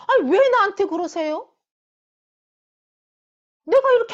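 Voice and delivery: high, loud, hammed-up self-defence; exasperated protest. Preacher plays Moses deflecting the people's complaints, exasperated. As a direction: push the blame away, indignant and overwhelmed. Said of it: "너무 힘들고 막 숨이 막힐 것처럼".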